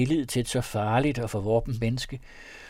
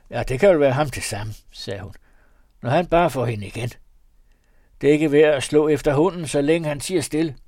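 louder, a hint of a gruff peasant woman; robber woman; 3 semitones higher, 6 dB louder than usual. A narrator's reading. Robber woman dismissing the young man's question, gruff. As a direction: brush him off with a proverb.

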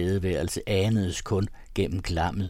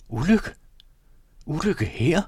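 higher, louder, alarmed cry; shocked shout; 8 semitones higher, 5 dB louder than usual. A narrator's reading. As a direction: call out in disbelief. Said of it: "råbte herren". (råbende og chokeret, høj tone)

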